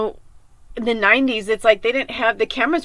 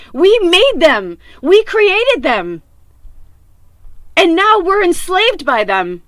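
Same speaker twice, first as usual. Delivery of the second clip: louder, higher, slow and emphatic; impassioned; 4 semitones higher, 4 dB louder, slower than usual. Real, not acted. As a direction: hammer each phrase home in anguish. Impassioned rant about created monsters.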